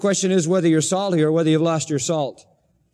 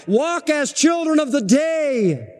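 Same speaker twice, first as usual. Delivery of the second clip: high, slow, called out over the room; exhorting call; 7 semitones higher, slower than usual. Acted. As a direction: call them out into the day. Quoted exhortation shouted.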